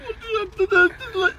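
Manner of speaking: pleading through tears. Real, not acted. Pleading man near tears.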